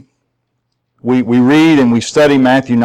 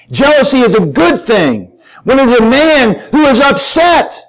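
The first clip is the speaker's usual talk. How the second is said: high, driving pulpit voice; raised, urgent sermon delivery; impassioned preaching; 11 semitones higher, 4 dB louder than usual. Real, not acted. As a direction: preach it hard, punching key words. Preaches at full force.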